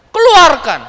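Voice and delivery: high, loud one-word command; angry snap. Retold or imitated. Caliph orders his child to spit out the apple, angry shout. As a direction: bark the order at a small child. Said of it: "Dibentak, Pak".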